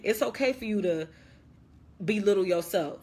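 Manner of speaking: normal talking voice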